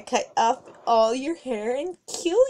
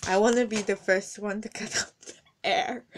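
ordinary delivery

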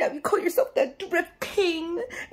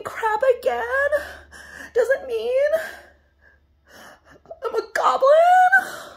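higher, sob-laced whining rant; whiny crying rant; 5 semitones higher than usual. Acted. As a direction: blubber your grievances. Goblin whining about Marty.

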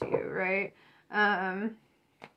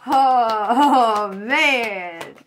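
loud laughing oh man; 2 semitones higher, 10 dB louder, much slower than usual. Real, not acted. Reader laughing at her own outburst. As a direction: burst out laughing, then breathe.

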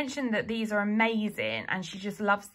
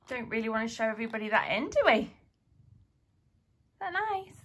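cat voice; faster than usual. Real, not acted. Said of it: "talks to their cat in a cat voice".

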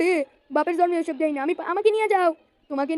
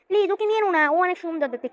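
high pleading voice; 5 semitones higher than usual. Acted. Character begging the other to take him, high plea. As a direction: plead in a squeaky cartoon voice.

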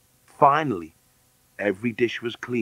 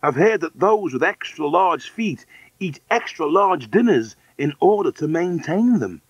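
higher, lilting character voice; reasoning adult; 7 semitones higher, 4 dB louder than usual. Acted. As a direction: argue the point with swooping pitch. Woman argues about feeding.

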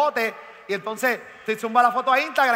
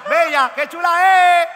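slow, loud, high gushing fan; mock gushing fan; 6 semitones higher, 8 dB louder, slower than usual. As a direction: swoon over the diva loudly. Mocks fans praising Ernita.

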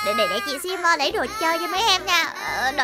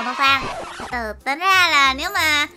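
normal talking voice

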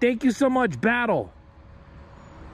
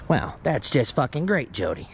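imitated unimpressed mom; 9 semitones lower, 5 dB quieter than usual. Acted mom's deadpan answer.

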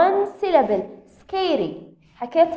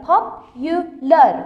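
Popular drawn out slowly, each syllable louder and clear; slow modelled word; 5 dB louder, much slower than usual. Shown as a demonstration. Teacher modelling Popular slowly for students, slow and loud. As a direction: stretch out Popular syllable by syllable for the class. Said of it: "هاي صفة طويلة".

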